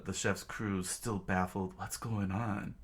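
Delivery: plain reading voice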